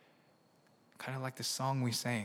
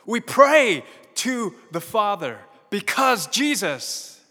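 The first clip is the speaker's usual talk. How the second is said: preaching at full force; 8 semitones higher, 13 dB louder than usual. Acted. Pastor rousing the congregation on Jesus' kingship, full-force preaching. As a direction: thunder that he reigns today.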